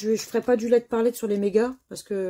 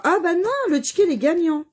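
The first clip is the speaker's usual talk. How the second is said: beaucoup plus haut et plus fort, vraie surprise; s'exclamer en découvrant un ticket gagnant; real surprise; 10 semitones higher, 7 dB louder than usual. Real, not acted. Discovers winning ticket. (surprise joyeuse, aiguë et forte)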